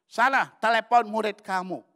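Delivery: high, loud order; acted loud command. Wife ordering husband to phone his student, loud acted command. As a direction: shout it as a sharp instruction.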